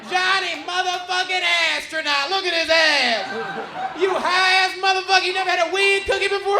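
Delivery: high, booming gleeful heckle; Ralphie. Retold or imitated. Headliner roasts the stoned comic, loud high jeering. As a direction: imitate a loud, high-pitched roar of teasing. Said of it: "Ralphie just looks at me and goes".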